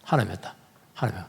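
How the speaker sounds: 낮게 조용히 되뇌듯; soft echo. Shown as a demonstration. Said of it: "이걸 알아야 돼요". (조용히 되풀이)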